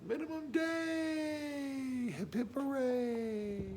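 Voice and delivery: high, slow sing-song cheer; mock celebratory whoop, drawn out; hooray cheer. Cheering the minimum day.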